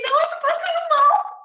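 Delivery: very high, loud tearful wail; retold crying. Crying to the gate attendant, very high and loud. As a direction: sob it out as if about to faint. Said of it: "Aí eu comecei a chorar de novo".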